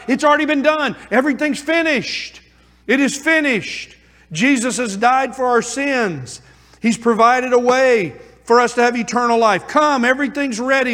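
fervent preaching. Acted. Preacher proclaiming salvation is finished, fervent. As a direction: proclaim it as good news.

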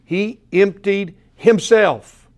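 slow, deliberate "He ... emptied ... himself"; emphatic stress. Shown as a demonstration. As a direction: land hard on EMPTIED and HIMSELF with gaps between. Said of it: "I'm emphasizing this".

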